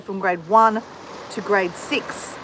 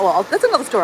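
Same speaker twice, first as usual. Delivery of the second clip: normal talking voice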